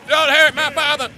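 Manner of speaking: high, loud mock yell; hammed-up screaming protest over a father; mock shouting. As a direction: shout it high, imitating an overdramatic character. Mimicking a show character's plea.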